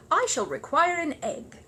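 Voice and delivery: high, slow, polite request; polite small bear. Acted. Paddington asking his audience for an egg, politely. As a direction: ask for the egg with ceremony.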